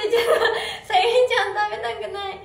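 fast, loud, laughing fix of the slip; laughing correction. Real, not acted. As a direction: blurt the correction through laughter. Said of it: "変なこと言っちゃった". (笑いながら慌てて言い直す)

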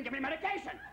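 high mock labor scream; mild mock scream. Woman in labor demanding drugs, high mock scream. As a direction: yell for drugs, mock panicked.

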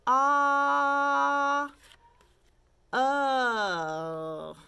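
"Ah, eh, eh, ah, oh" each drawn out on an even note; held vowels. Shown as a demonstration. Teacher running through the vowels, each from "Ah" to "oh" held long. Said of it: "let's do 'em again".

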